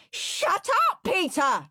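put-on high furious child's scream. A narrator's reading. Henry silencing perfect Peter, screamed. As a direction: scream at your little brother. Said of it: "screamed Henry".

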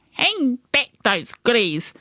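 a touch of high-pitched bossy warning; mildly commanding granny. Acted. Granny threatens the robbers in story.

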